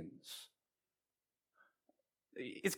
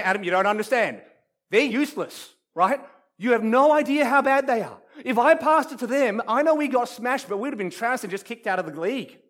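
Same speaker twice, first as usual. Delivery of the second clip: louder, higher, indignant objection; protesting listener; 7 semitones higher, 6 dB louder than usual. Acted. Church listener objects that their friends are useless, loudly protesting. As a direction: argue back loudly, voice pitched up. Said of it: "you're sitting there going".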